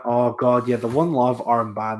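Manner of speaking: ordinary talk, nothing marked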